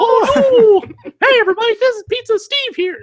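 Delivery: very high, loud, fast squeaky cry; high squealing Mister Bill. Retold or imitated. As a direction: wail it like a tiny clay victim. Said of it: "the Mister Bill voice".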